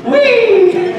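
high, loud mock "whee"; mock cheer. Preacher mocking the find of two fish, sarcastic cheer. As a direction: squeal in sarcastic celebration.

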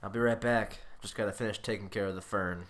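understated cover story; furtive husband. Acted. Charles sneaking time with the fern.

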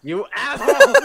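shrieking You asshole; angry shout. Real, not acted. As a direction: yell it at the top of your voice. Friend steals his item.

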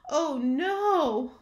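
loud, high "oh no"; alarmed. Acted. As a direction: gasp it out in alarm. Dramatic story moment.

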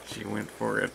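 ordinary talk

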